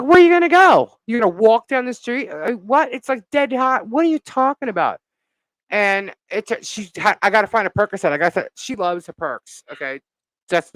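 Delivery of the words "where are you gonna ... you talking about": high, loud disbelief, fading down; can't believe what she's hearing; retelling her reaction to her friend's plan, high and incredulous. Retold or imitated.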